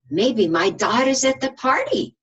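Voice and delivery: hopeful little letter. Acted. Little I hoping her dot is at the party. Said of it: "little I said".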